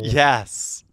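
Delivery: loud, drawn-out yes; loud, long comeback to a protest; playful insistence. Real, not acted. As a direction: insist loudly, stretching the word out. Playful dispute over punny title.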